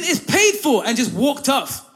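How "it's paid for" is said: raised, higher firm announcement; firm declaration. Retold or imitated. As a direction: tell him it's settled, loudly. Cousin declares it paid.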